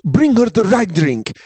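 high, slow, imperious demand; bossy husband. Retold or imitated. Husband commanding waiter for his wife, high imperious order. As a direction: talk down to the waiter. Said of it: "And her husband goes".

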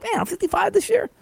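high, put-on voice of a tired old guy; mock old man. Host imagining his older self, mock griping in a high voice. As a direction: whine it out like a creaky fifty-five-year-old.

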